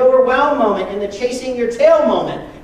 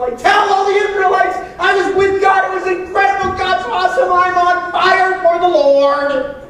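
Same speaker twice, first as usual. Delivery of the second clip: high, loud, thrilled shouting; bursting with joy coming down the mountain; fired-up Moses; 8 semitones higher, 5 dB louder than usual. Retold or imitated. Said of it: "he's like".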